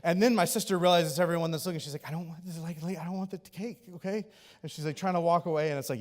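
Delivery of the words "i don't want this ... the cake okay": much softer, self-conscious retort; embarrassed hushed refusal. Retold or imitated. Sister embarrassed as everyone looks.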